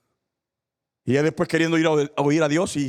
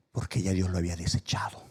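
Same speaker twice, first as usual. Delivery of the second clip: hushed whisper; 7 semitones lower, 5 dB quieter than usual. Real, not acted. Pastor warns the faithful, grave whisper. Solemn point in sermon.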